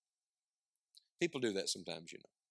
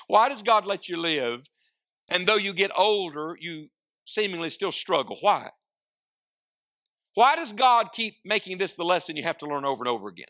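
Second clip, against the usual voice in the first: preaching; 5 semitones higher, 4 dB louder than usual. Acted. Pleading questions to congregation.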